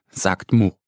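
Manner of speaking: plain reading voice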